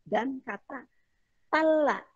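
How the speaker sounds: talla with a plain t, not d; Arabic word talla. Shown as a demonstration. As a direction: say talla with the t clearly. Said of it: "contohnya itu dalla". (talla dengan bunyi t)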